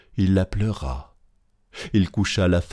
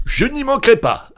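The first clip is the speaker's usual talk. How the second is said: very high, louder reply; aubergiste empressé; 5 dB louder than usual. A narrator's reading. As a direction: agree readily, pitched right up. Innkeeper gladly agrees. (réponse empressée, très aiguë)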